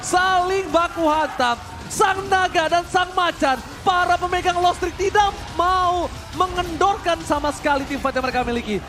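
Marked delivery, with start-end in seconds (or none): 0.0-7.2 dramatic announcer. Acted. Dramatic casting build-up.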